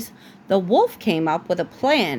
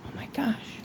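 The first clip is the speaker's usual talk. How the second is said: breathy whispered aside; 2 semitones higher, 13 dB quieter than usual. Real, not acted. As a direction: whisper it under your breath. Parent reading, whispered aside.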